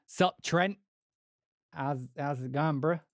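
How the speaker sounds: laid-back American bro greeting to Trent; casual dude greeting. Retold or imitated. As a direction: greet him like an easygoing bro, "how's it going, bruh". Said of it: "call [name] now and say what".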